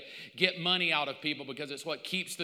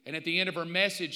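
usual speaking voice

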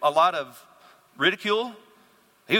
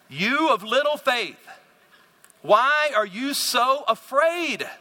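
slow, higher-pitched reproach; stern rebuke; 6 semitones higher, much slower than usual. Acted. Jesus to his frightened disciples, slow rebuke. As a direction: draw out the reproach word by word.

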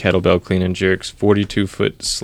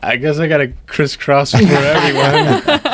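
said through a laugh, a bit higher; 6 semitones higher than usual. Real, not acted. Joking to the hosts about leading warm-ups, laughing. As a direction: joke it out while still laughing. Joking after the funny story.

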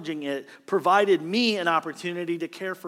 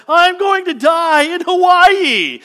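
high, slow, loud panicked wail; panicked cry; 13 semitones higher, 12 dB louder, slower than usual. Retold or imitated. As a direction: cry out like you're sure you'll drown. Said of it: "he cries out and he says".

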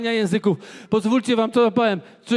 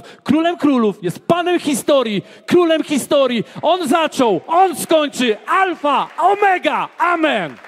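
higher and louder, building to a shouted climax; preacher roars the titles of God to the congregation; full-force preaching; 8 semitones higher, 5 dB louder than usual. Acted. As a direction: proclaim every title like a battle cry. Sermon climax praising God.

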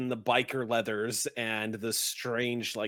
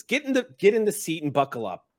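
raised, louder scolding voice; stern order; 6 semitones higher, 5 dB louder than usual. Acted. Red cap sends the goblin to the back seat, stern and raised.

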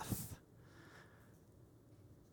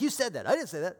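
high, quick indignant protest; a touch of mock outrage; defensive denial; 7 semitones higher, 5 dB louder, faster than usual. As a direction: bristle like someone caught out. Caught, denying what you said.

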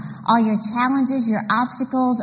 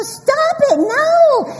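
high, louder laughing protest; whining child; 6 dB louder, much slower than usual. Retold or imitated. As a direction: squeal at her to stop. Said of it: "And I'd go".

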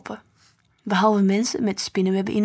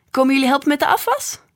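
higher, louder and fast, a jolly invitation; cheerful joking question; 5 semitones higher, 5 dB louder, faster than usual. A narrator's reading. Stef jokes at the door. (vrolijk en snel gevraagd)

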